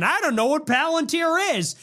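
high, mock-innocent denial; playing dumb, lightly voiced; mocking Tucker. Mocking Tucker's podcast denial, high and faux-innocent. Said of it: "Tucker goes on Theo Von".

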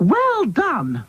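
high voice after puffed breaths; puff, then praise in a high voice; panting praise. A narrator's reading. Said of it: "panted Dotty".

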